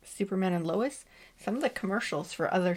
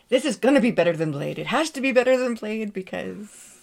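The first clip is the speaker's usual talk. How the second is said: mock Wesley Snipes rant; 3 semitones higher, 8 dB louder than usual. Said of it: "It's like".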